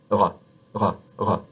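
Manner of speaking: "ra" with a throaty French "r", mouth open. Shown as a demonstration. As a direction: uvular "ra", tongue tip hidden. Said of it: "So here".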